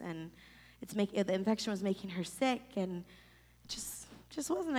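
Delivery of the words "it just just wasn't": whispered, holding back tears. Real, not acted. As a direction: breathe the words out unvoiced. Whispered grief over mom.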